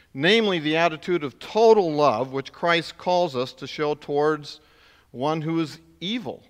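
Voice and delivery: normal talking voice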